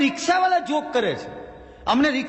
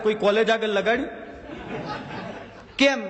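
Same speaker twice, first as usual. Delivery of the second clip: usual speaking voice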